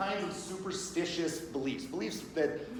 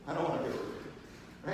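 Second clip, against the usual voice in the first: hushed refusal; 6 dB quieter than usual. Acted. Friend declining the ride.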